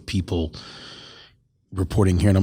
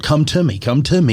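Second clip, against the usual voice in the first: acted beckoning, pitched up; coaxing call; 6 semitones higher than usual. Man retold calling a woman across the river, coaxing call. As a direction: call someone over, lightly acted.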